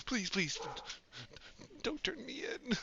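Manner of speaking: high, frightened begging robot; pleading robot. Acted. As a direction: beg desperately, fading to a whisper. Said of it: "and he's like".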